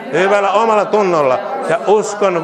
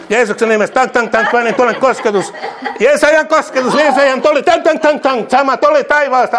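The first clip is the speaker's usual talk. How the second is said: ecstatic preaching; 5 semitones higher than usual. Acted. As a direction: pour fire over him with rising, laughing fervour. Ecstatic prayer over believer.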